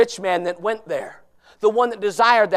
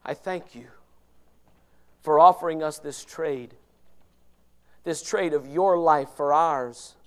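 closing prayer; 6 semitones lower than usual. Acted. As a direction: pray humbly and thankfully.

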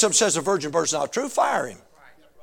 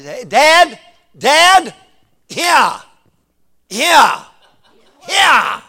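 put-on loud high calls of a kid for dad; little boy calling Dad again and again; child calling out; 8 semitones higher, 15 dB louder, much slower than usual. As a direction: yell for dad from the bedroom. Son calling from bedroom at night.

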